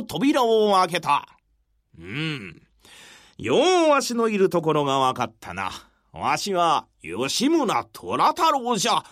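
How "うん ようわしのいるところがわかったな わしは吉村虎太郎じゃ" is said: put-on low, weathered old warrior; lower, aged, resolute samurai; creaky wise old man. Yoshimura faces soldiers.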